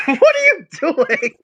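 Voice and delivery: loud, high, slow exclamation; drawn-out incredulous question; baffled. Real, not acted. Player to friend choking with laughter, baffled loud question.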